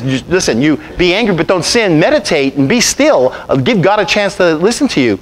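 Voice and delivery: ordinary talk, nothing marked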